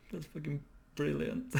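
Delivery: high-pitched, squeezed out through laughter; delighted, barely getting it out for laughing. Real, not acted. Still laughing at the face.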